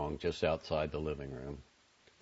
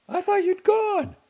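very high, loud motherly character voice; mother's high-pitched surprise; surprised mother; 10 dB louder than usual. A narrator's reading. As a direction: exclaim it high as a startled mother. Startled mother meets son in hall.

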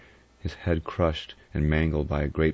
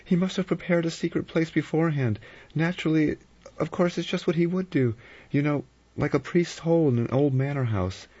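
high-pitched, insistent woman character; Vera, urgent; 12 semitones higher than usual. Acted. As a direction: reason it out eagerly, pitched right up.

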